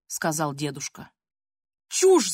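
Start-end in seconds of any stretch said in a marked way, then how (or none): 1.9-2.3 short, high yell; one-word outburst, pitched up. A narrator's reading. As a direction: yell it out as an outraged girl. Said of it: "завопила Полина".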